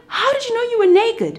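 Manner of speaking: a high, questioning God voice; lightly voiced God probing. God questions Adam and Eve, lightly voiced. God asks how they knew.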